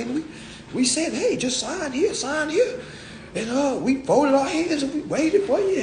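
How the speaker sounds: mock innocent dealer, high and soft. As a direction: play the sweet fake-innocent dealer. Dealer voiced, second take.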